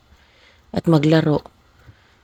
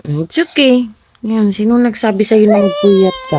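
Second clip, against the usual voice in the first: usual speaking voice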